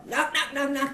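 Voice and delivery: plain reading voice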